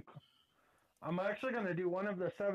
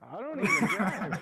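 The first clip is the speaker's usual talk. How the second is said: loud retold protest; 4 semitones higher, 8 dB louder, slower than usual. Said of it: "I was like".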